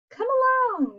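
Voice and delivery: high, bright little squeal; squeaky mouse. Acted. Mouse calls Bear along, high squeal. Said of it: "Mouse squeals".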